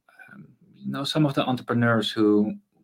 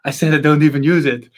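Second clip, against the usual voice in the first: caught out; 4 semitones higher, 7 dB louder than usual. Retold or imitated. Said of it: "And he was like".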